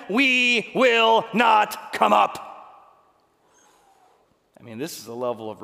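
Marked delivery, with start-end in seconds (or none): 0.1-2.4 very slow, loud, stubborn refusal; drawn-out refusal. Acted. Rebels refusing Moses, slow and stubborn. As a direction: stretch every word in stubborn defiance.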